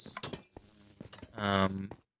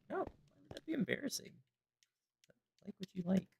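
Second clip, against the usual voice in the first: much higher, airy mock reply; breezy classmates; 10 semitones higher, 10 dB quieter than usual. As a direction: toss it off as obvious. Classmates answer the professor.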